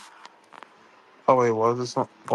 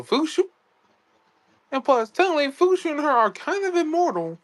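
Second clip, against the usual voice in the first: excited; 12 semitones higher than usual. Real, not acted. Excited point about the character.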